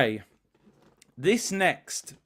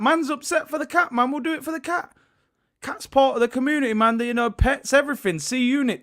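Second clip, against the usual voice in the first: high, pitched-up mock outrage; mock indignant; 6 semitones higher than usual. Streamer jokingly defends the cat to chat, high mock indignation. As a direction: play it hurt for the cat.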